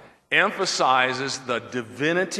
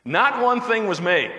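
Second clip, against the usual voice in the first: "Not one thing was made" with a real laugh running through it; amused, laughing retake of "Not one thing was made"; 7 semitones higher, 4 dB louder than usual. Real, not acted. Laughs right before and through it.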